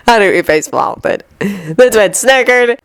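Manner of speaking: plain reading voice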